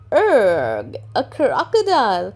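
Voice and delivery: loud startled cry; teacher alarmed at a crocodile; cries in alarm. Acted. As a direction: recoil from the crocodile. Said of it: "cries Madam Gazelle".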